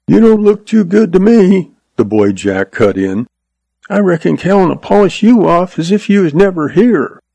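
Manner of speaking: put-on high, cocky young boy; lightly voiced scornful kid; boy's voice. A narrator's reading.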